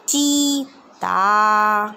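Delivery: चीता with the ची held long, very slow; long ee drill. Shown as a demonstration. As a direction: stretch the ee in चीता for the class. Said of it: "ई करके।".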